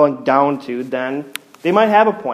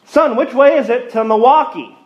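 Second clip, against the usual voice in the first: higher, louder impatient question; 8 semitones higher, 4 dB louder than usual. Retold or imitated. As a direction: snap the question at the kid. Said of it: "a bit impatiently".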